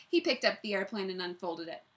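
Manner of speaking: ordinary delivery, nothing marked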